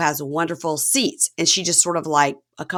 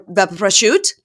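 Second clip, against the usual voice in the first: louder, savoring prosciutto and provolone; faux Italian-American; 3 semitones higher, 7 dB louder than usual. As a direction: lean into the Italian food words. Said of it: "I'm like, "Okay, you're from Connecticut".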